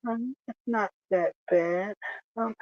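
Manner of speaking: soft, hushed comforting coo; mock-tender consoling. [name] consoles his upset coworker, mock-tender and soft. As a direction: soothe him gently like a doting sweetheart. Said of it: "he puts his arm around Steve".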